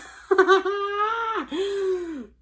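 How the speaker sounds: long high-pitched laugh. Real, not acted. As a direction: laugh in disbelief. Reacting to the vitamin C.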